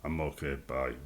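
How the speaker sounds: grudging, wants to leave; reluctant brush-off. Retold or imitated. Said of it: "people going".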